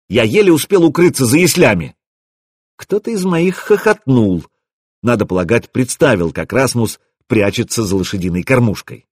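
ordinary delivery, nothing marked

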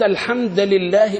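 "الحمد لله" as al-hamda lillahi; fatha on dal, slow. Shown as a demonstration. Teacher demoing the fatha reading slowly. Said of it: "بفتح الدال".

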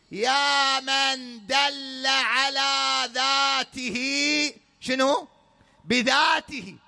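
slow, emphatic prayer line; preaching recitation. Acted. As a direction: proclaim each word of the prayer. Reciting Dua al-Sabah with force. (تلاوة دعاء بقوة وبطء)

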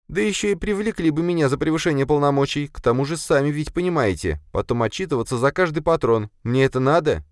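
sustained high female-character voice; Sofia, objecting subordinate. Acted. Sofia justifies not shooting to her boss, objecting. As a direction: argue your case, pitched well up.

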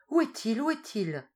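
the first high and loud, the second falling back; panicked searching cry; frightened cry. A narrator's reading. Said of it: "s'écria la pauvre fille". (cri affolé de la jeune fille)

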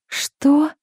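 high, startled question; taken aback. A narrator's reading. Mother thrown by her daughter's request, taken aback. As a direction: pitch it up in stunned surprise. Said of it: "мать оторопела".

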